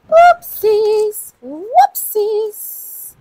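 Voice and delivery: high, loud, sing-out oops; playful squeal. Real, not acted. Host makes fun of own slip, high playful squeal. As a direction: pitch it way up, playfully overdone.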